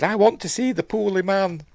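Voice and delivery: high-pitched imitation of a child; little daughter. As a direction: pipe up as the young daughter. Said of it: "She's got a daughter".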